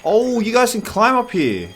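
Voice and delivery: high, loud burst of real surprise; surprised discovery. Real, not acted. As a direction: exclaim it loudly as you catch them out. Discovers animals climbing out of habitat.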